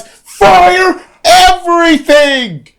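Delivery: high, drawn-out mock battle yell; imitating a top-of-the-lungs yell; retold yell. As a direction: stretch it out high like a hammed-up war cry. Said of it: "I just yell from the top of my lungs".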